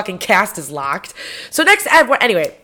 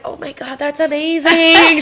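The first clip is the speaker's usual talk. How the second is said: gushing mom impression; 8 semitones higher, 4 dB louder than usual. Mom reacting to daughter's dog story, mock gushing. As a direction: imitate a mom thrilled by the news. Mom's delighted reply, acted.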